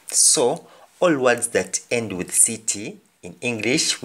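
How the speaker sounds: ordinary delivery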